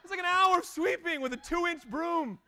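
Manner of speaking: high, loud mock outrage at the buddy; sarcastic snap. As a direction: bite back like a put-upon sweeper. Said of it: "And I was like".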